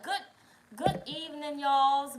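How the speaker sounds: lifted, drawn-out evening hello; sing-song greeting. Real, not acted. Livestream host greeting arriving viewers, sing-song and loud. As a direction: call out a welcome.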